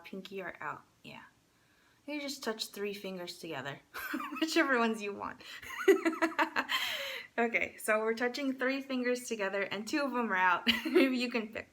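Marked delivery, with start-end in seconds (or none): 5.4-7.3 bright high-pitched giggle; giggling at her own mistake. Real, not acted.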